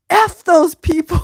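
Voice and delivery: high, loud, laughing echo of mom's curse; mom's cuss. Retold or imitated. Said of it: "I liked that she said".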